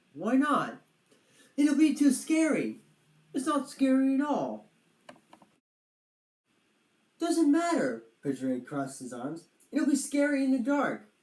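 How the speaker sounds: lightly hammed squabbling friends; childish back-and-forth. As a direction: argue like two kids. Quarrel over scary story.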